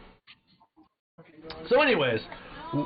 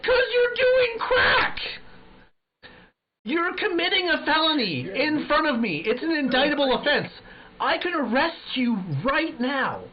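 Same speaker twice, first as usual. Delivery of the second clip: mock yell; 12 semitones higher than usual. Yelling at crack smokers.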